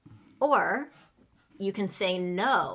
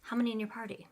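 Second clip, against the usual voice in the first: quick, run-together host question; really fast; 3 semitones higher, 4 dB quieter, much faster than usual. Shown as a demonstration. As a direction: rattle off the question like busy restaurant staff. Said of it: "they might say it really fast".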